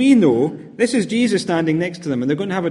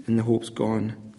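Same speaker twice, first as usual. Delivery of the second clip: low, slow and soft, defeated; hopeless; 7 semitones lower, 7 dB quieter, slower than usual. Acted. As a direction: let the words sink into despair. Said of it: "the sense of hopelessness".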